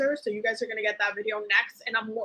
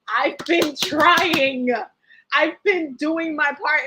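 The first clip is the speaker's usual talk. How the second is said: drawn-out plea; 3 semitones higher, 4 dB louder, much slower than usual. Retold or imitated. Quoted inner voice, second take.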